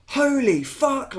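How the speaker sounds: high, slow shocked gasp of a curse; retold horror at the stabbing; stunned exclamation. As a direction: let it out in disbelief. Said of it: "And I was like".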